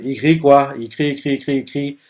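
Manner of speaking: ordinary talk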